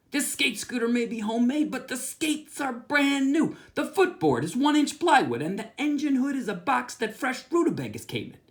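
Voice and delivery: a lightly voiced kid bragging up his scooter; Chester's voice. Narrator voices Chester's sales pitch.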